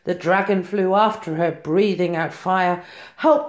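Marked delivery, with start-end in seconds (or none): 3.2-3.5 high desperate help; cry for help. A narrator's reading. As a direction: call out in fear. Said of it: "cried the witch".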